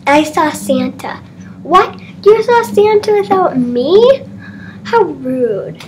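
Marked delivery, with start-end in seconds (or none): 1.6-4.2 high, shocked whine; indignant surprise. Acted. Sibling outraged, high pitch. 4.8-5.8 sulky. Acted. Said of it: "you get that how rude from me".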